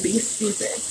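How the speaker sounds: a quiet, sarcastic throwaway; muttered aside. Real, not acted. Telling listeners to go ahead, muttered softly. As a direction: drop it soft under your breath. Repeats the phrase, dropping voice.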